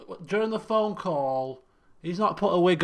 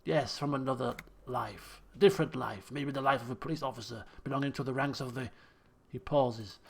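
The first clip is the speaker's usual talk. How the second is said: low, soft dramatic read; reading game dialogue; 5 semitones lower, 5 dB quieter than usual. Acted. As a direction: voice a measured, hypothetical musing. Reads game dialogue and narration.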